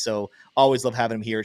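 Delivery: ordinary talk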